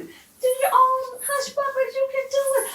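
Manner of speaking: rally the viewers like a cheerleader; cheering on. Real, not acted. Cook cheering her viewers to make hush puppies, high excited shout.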